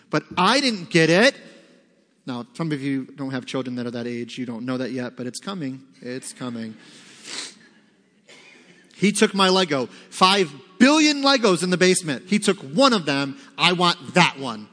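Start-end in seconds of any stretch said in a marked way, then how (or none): 0.1-1.3 put-on loud, higher whine of a five-year-old; tantrum whine. Pastor mimics a five-year-old's tantrum, loud whine. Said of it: "five-year-old throwing a tantrum". 8.9-14.7 put-on kid's tantrum. Pastor plays a child fighting over Lego, loud whine. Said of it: "five-year-old throwing a tantrum".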